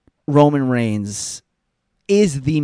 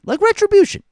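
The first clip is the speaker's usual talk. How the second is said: mock announcer; 6 dB louder than usual. Mocking a hyped stable name.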